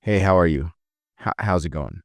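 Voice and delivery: alpha, no inflection. Shown as a demonstration. Trainer demonstrating the alpha greeting, low with no inflection. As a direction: keep it low and certain, no lift. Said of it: "That's alpha, no inflection".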